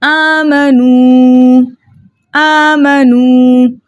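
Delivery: आमनू recited slowly with long aa and held final oo; elongated vowels. Shown as a demonstration. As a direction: stretch आमनू, sustaining the last oo on a level note. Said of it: "दो हरकत की मिकदार खींच कर पढ़ेंगे".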